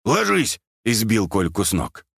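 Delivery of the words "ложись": high, sudden warning bark; sharp raised-voice command. A narrator's reading. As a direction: bark it as an order to take cover. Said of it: "пельмень гаркнул".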